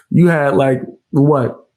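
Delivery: ordinary delivery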